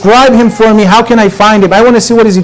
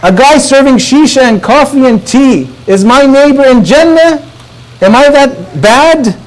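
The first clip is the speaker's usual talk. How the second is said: higher-pitched, offended questioning; upset disbelief; 6 semitones higher than usual. Retold or imitated. Scholar questioning his neighbour in Jannah, upset and incredulous. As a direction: retell an upset man's incredulous protest. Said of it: "Bahr al-Ulum was upset".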